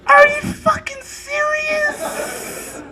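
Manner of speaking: very high, slow, laughing disbelief; incredulous shriek. Acted. Comic to the audience about the classmate, a high incredulous shriek. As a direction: stretch it out in shocked disbelief.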